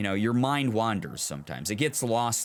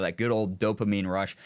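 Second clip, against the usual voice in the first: usual speaking voice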